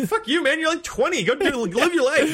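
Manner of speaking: high, exasperated rant. Acted. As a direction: scold him with mock outrage. Mock scolding the proper character.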